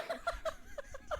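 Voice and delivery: squeaky burst of laughter; high laugh. Real, not acted. Laughs at own outburst.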